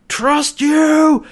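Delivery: high, loud mock yell, falling at the end; shouted exclamation. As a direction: bellow it in imitated outrage. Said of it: "he's just shouting".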